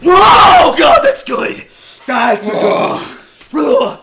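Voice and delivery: high, loud gleeful exclamation; delighted shouting. Acted. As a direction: shout it out in glee.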